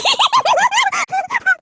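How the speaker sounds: high, shrieking victory cackle; triumphant cackle. Acted. Killer cackles at the court after winning the point.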